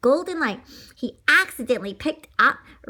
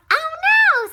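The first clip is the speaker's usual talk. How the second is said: very high, loud panicked duck voice; 8 dB louder than usual. Acted. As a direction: squawk it out in fright. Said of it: "said Sammy the duck".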